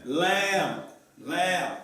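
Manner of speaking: "Lamb" with a drawn-out front "a", like "laam"; correct pronunciation. Shown as a demonstration. Teacher modelling the correct pronunciation, "lamb" with a long front "a". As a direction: model "lamb" clearly, front vowel held. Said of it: "What is the correct pronunciation?".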